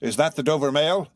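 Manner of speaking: high, loud questioning hail, rising at the end; calling from the mist. A narrator's reading. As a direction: call out to a distant coach. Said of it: "a man's voice called from the mist".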